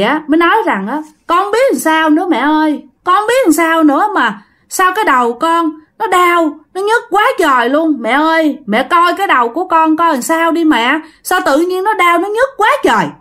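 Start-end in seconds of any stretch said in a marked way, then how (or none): 1.3-13.1 higher, plaintive son's voice; pained pleading. A narrator's reading. Son begs his mother to check his head, pained pleading. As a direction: whine about an aching head, climbing in pitch.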